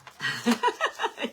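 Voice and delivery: loud high laugh bursting out; burst of laughter. Real, not acted. Laughing at her own worry.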